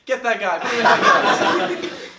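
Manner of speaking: egging the cops on, hammed-up; mock urging shout. Acted cheering on of the cops.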